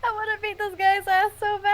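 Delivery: yelling in frustration, near crying; furious shout. Real, not acted. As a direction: shout it out, half laughing, half sobbing. Player furious at villain.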